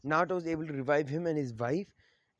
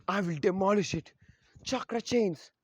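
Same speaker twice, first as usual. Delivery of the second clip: raised, high-pitched battle cry; attack call; 4 semitones higher than usual. Acted. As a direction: climb higher into the technique's name. Kushina calls out her attack.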